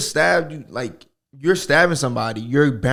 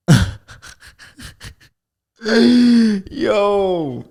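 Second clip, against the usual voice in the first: laughing; 9 semitones higher, 7 dB louder, much slower than usual. Real, not acted. Laughing fit, squeaky.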